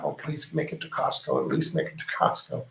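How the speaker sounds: soft plea fading into a whisper; quiet silent plea. Retold or imitated. The driver silently begging the tense trip reaches Costco, whispered. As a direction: pray under your breath to get there. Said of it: "And I thought".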